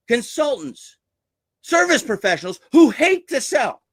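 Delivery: high-pitched, theatrical sales pitch; theatrics. Shown as a demonstration. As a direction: play it up to grab their attention. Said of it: "what I'm doing right now is theatrics".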